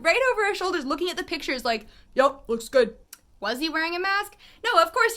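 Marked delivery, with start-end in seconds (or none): 2.1-3.0 lightly imitated man, louder than usual; low male voice. Man judging photos over her shoulder, mock male voice. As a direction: put on a nosy man's approving voice. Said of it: "low male voice".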